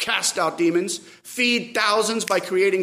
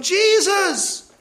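high, drawn cry for help; yell; 11 semitones higher, 4 dB louder than usual. Acted. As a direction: yell out the name at a high pitch. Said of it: "yells for him".

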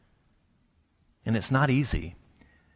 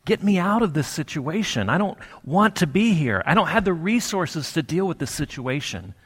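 higher-pitched, urgent appeal to God; pleading prayer; 5 semitones higher than usual. Acted. Preacher voicing a prayer to get out of a situation, pleading. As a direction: plead upward, as if wanting out. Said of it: "it's okay in those moments to pray".

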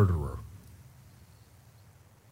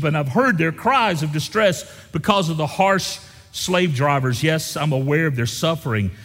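scripture reading; 5 semitones higher, 3 dB louder than usual. Acted.

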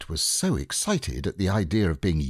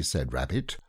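plain reading voice

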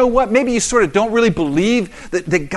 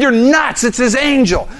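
scoffing; 7 semitones higher, 5 dB louder than usual. Retold or imitated. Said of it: "I said".

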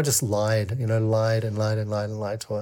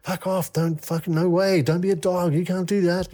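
higher, frantic protest replayed from memory; retold panic, pitched up; panicked pleading; 7 semitones higher than usual. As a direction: push back like a cornered animal, half-acted. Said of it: "and I started panicking, you know, like this caged animal".

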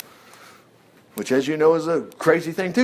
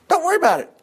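carefree high; 10 semitones higher, much faster than usual. Acted. Reassuring repeated phrase.

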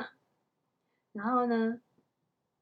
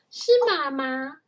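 excited exclamation; 3 semitones higher, 10 dB louder than usual. A narrator's reading. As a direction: exclaim that you've spotted Mum. Child spots mother outside.

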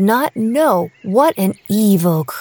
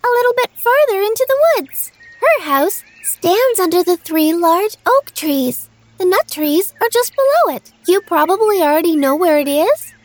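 put-on high, sweet little girl; bright young girl promising; 9 semitones higher than usual.

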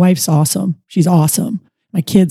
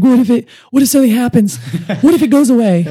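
anxious player; 7 semitones higher, faster than usual. Retold or imitated. The player on the phone to his coach, anxious and higher. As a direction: let the worry pour out. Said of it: "he's like".

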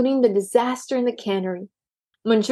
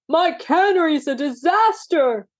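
angry owner; 6 semitones higher than usual. A narrator's reading. Owner hollering about mess.

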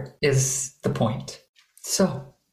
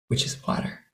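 comforting hush; 7 dB quieter, faster than usual. Shown as a demonstration. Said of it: "the sound of comfort".

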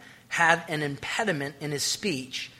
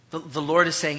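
ordinary talk

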